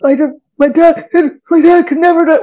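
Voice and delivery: high, loud mock-sobbing voice; choked-up imitation of a weeping interviewee; imitated crying. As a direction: act out a man breaking down in tears. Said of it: "in tears".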